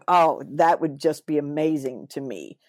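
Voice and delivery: ordinary talk, nothing marked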